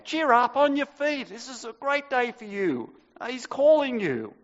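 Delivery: high, eager crowd voices calling out; excited call. Acted. Crowd calling the blind man to Jesus, excited. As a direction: call him over, cheering him on.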